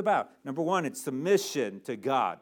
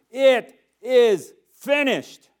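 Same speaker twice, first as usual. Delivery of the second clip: very loud, high, drawn-out cry; 10 semitones higher, 11 dB louder, much slower than usual. Acted. Jesus on the cross at his death, crying out. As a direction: shout it slowly, word by word. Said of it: "Jesus cries out these words".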